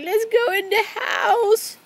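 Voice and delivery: high, slow mimicking voice; imitating wife. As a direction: mimic her in a high pleading voice. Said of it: "She's like".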